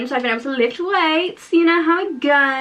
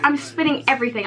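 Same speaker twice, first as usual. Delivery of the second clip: ordinary delivery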